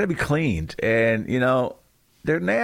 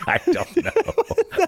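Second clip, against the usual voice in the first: laughing; 9 semitones higher, much slower than usual. Real, not acted. Laughing at halftime slip.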